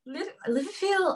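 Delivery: struggling Scouse imitation; Liverpool accent attempt. Shown as a demonstration. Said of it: "Um, Liverpool?".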